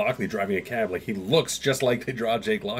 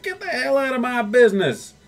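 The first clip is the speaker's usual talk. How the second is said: annoyed hooker; 13 semitones higher, 4 dB louder than usual. Retold or imitated. Hooker tells off Moon Knight.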